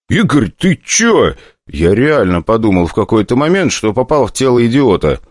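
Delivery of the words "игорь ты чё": exasperated. Acted. Hero snaps at Igor.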